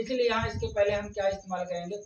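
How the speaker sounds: ordinary delivery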